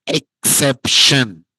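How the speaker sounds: exception drawn out slowly for the class; slow, deliberate. Shown as a demonstration. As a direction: stretch out exception syllable by syllable.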